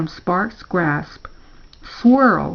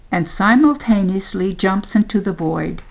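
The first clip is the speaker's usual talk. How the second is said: ordinary delivery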